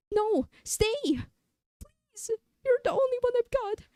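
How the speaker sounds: high pleading whine; desperate plea. Acted. Other self begs the leaving streamer to stay. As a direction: beg them not to go.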